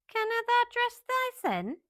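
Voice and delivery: amazed broad Yorkshire burst; astonished maid in broad Yorkshire, high and loud. Acted. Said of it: "She spoke in a broad Yorkshire accent in her amazement".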